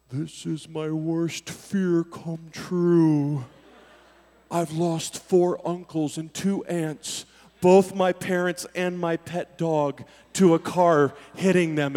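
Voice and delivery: low, very slow lament; deer's slowed-down thoughts. Retold or imitated. Deer facing oncoming headlights, slow-motion lament. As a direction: drag it out like slow-motion dread. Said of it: "everything really did slow down in this moment".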